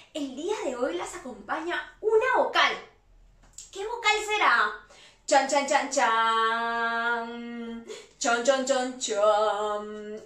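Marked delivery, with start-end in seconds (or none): 5.3-10.2 low "chan chan" with a long held last note; suspense drumroll. Pretend. Teacher building up to the vowel reveal, suspense drumroll. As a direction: intone a mock drumroll, stretching the final chan.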